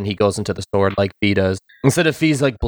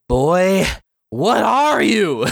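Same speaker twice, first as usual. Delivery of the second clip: high, slow awed villain; villain stunned at his foe; quoting Ghirahim; 8 semitones higher, much slower than usual. Retold or imitated. The villain facing the hero, stunned and awed. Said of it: "He goes".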